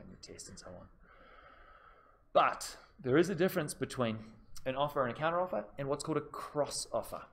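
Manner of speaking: usual speaking voice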